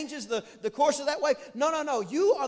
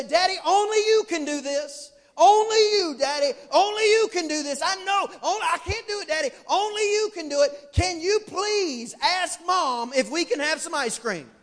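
put-on higher, louder pleading kid voice, laying it on thick; butter up daddy with a high, louder plea; sweet-talking kids; 5 semitones higher, 7 dB louder than usual. Kids flattering their dad to get ice cream, in a higher, louder pleading voice. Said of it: "they say".

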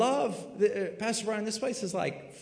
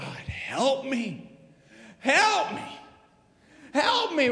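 climbing higher with each help me; desperate plea; 6 semitones higher, slower than usual. Pretend. Sinner crying out to God, desperate and building. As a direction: beg harder and higher each time.

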